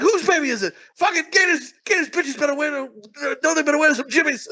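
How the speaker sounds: high, blustering tirade; mock angry rant. Husband reacts to wife's pregnancy as Gator, mock angry rant.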